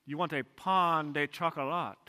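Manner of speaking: lightly voiced French clerk, pain de chocolat said the native way; a mild French shopkeeper correcting; French clerk accent. Clerk repeats the order back to tourist, light French accent.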